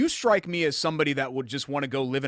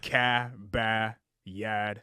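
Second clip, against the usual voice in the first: slow, drawn-out Boston "Cabba Yad" with a flat hard A; a slow demo of Boston "Cabba Yad"; Boston accent; 2 semitones lower, much slower than usual. Shown as a demonstration. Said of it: "What's that again?".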